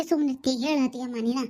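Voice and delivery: ordinary talk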